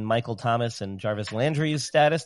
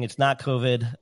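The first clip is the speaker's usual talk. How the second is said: ordinary talk, nothing marked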